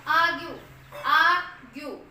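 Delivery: आर्ग्यू said loud, the second take slow; teacher models आर्ग्यू; model word argue. Shown as a demonstration. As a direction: call out आर्ग्यू loudly, then stretch it. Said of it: "next देखो".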